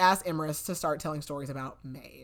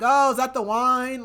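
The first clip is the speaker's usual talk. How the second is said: lightly played drunk, higher and louder; pretend drunk; 6 semitones higher, 6 dB louder than usual. Man faking drunkenness for the guards, lightly acted tipsy. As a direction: toss it out as a mock drunk. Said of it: "pretending he's drunk or whatever".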